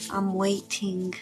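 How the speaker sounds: ordinary talk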